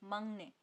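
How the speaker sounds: मंगने with ng, not k; nasal assimilation. Shown as a demonstration. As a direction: say मंगने, k becomes ng before n. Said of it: "इसको पढ़ते हैं".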